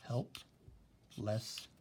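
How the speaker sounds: very slow, each syllable drawn out; slow syllable demo. Shown as a demonstration. Teacher showing the class how to split Helplessness, very slow. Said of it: "how you would do syllables".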